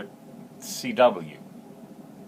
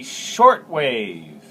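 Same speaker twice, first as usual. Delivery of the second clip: Shortwave with the weight on Short, wave light; stress on first syllable; 2 semitones higher, 8 dB louder than usual. Shown as a demonstration. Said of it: "the emphasis on the first syllable".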